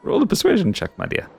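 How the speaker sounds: high, giddy creature delight; creepy giggly voice. Acted. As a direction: coo over him like a mad collector. GM in shopkeeper voice.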